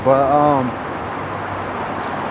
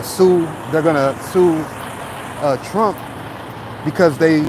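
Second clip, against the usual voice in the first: ordinary talk, nothing marked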